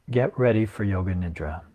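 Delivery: even script reading with long pauses; guided meditation. Acted. Yoga teacher reading Yoga Nidra to resting listeners, guided meditation. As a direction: guide listeners unhurriedly, leaving long silences between phrases.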